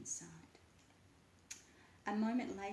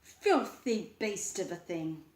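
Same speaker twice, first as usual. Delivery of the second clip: louder and slow, full of disgust; slow disgusted growl; 9 dB louder, slower than usual. A narrator's reading. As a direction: sneer it out. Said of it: "he replied".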